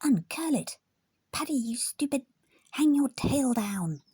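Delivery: high, hissed stage whisper, urgent and cross. A narrator's reading. Sandy to the elephant mid-parade, urgent angry whisper. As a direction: whisper fiercely so the crowd won't hear. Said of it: "he whispered to the elephant".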